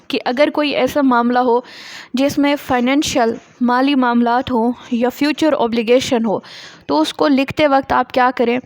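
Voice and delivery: normal talking voice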